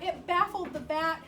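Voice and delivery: usual speaking voice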